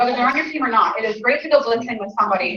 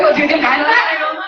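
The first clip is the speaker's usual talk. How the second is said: laughing comeback; 2 semitones higher than usual. Retold or imitated. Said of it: "That's what some people have said back".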